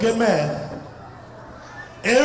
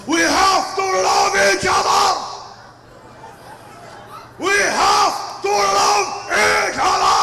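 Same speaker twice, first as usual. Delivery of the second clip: angry lecture shout; 8 semitones higher, slower than usual. Retold or imitated. Egyptian lecturer yelling about love, furious shout. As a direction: bellow the plea for love in fury. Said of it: "he goes".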